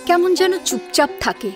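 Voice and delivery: ordinary talk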